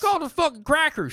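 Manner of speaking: high-pitched hillbilly caricature. Acted. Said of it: "Let's hear your redneck".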